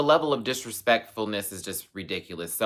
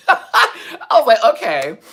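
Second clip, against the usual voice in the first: laughing, high and loud; 14 dB louder, slower than usual. Real, not acted. Vlogger laughing off hackers' threats, loud high laughter. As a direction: crack up at the scam threat.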